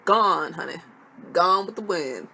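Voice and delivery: loud dramatic delivery. Acted. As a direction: belt it out dramatically. Dramatic punchline about voice.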